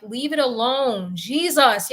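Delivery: ordinary talk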